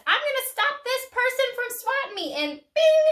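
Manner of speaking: high, loud mock-furious bee; angry, scared bee. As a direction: play a riled bee charging in, ending on a ringing sting noise. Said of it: "it gets angry, and it gets scared".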